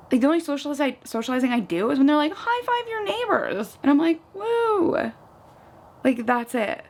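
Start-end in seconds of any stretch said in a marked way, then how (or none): 2.3-3.8 high, drawn-out mock instructor call; mock fitness-class call, pitched way up; imitating class instructor. Acting out gym class instructor's call. 4.3-5.1 high, thin "woo"; mock cheer. Mimics own response in gym class.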